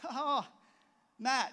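eager greeting. Retold or imitated. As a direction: call his name out brightly. Greeting the guy by name.

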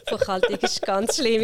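groan-laugh at how awful it was; amused dread at accounting. Real, not acted. Names the subject she hated.

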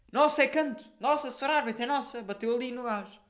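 high, loud protest to the referee; excited appeal. Retold or imitated. Player appealing to the referee for a corner, excited. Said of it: "eu já estava tipo".

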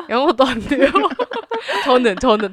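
laughing through the words. Real, not acted. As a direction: break into giggles mid-sentence. Joking about poor Korean. (웃으면서 말하기)

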